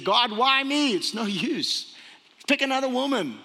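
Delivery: high-pitched complaint to God; acted protest. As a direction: plead your way out, pitch jumping up. Acts out Sarah protesting to God.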